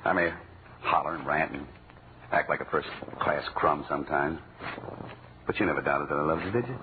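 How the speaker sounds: low, soft, sincere confession; tender sincere. Acted. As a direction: speak softly from the heart.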